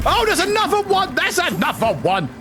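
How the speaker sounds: high startled cry, easing down; high-pitched real alarm, starting at the top; alarmed surprise. Real, not acted. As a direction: yelp it as a second threat appears. Spots another enemy in game.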